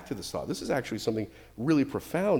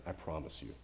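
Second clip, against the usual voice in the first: much lower and softer, calm and certain; voice of God; 11 semitones lower, 14 dB quieter than usual. Retold or imitated. God reassuring Abraham of his love, low and calm. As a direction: speak as God, low and unhurried. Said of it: "that's what God was doing".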